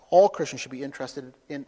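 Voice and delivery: normal talking voice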